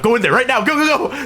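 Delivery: high, mock-frantic go-go-go; urgent hurrying. Friend hurrying him into the truck, urgent retold command. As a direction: rush them along, pitch climbing.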